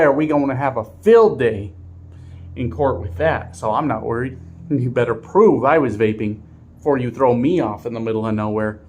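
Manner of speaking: usual speaking voice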